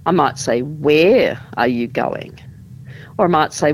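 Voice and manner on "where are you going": "Where" drawn out and leaned on, slow question; slow demo question weighted on "Where"; stress on WHERE. Shown as a demonstration. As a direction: hit "Where" hard, then let "are you going" follow. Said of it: "we might stress certain words".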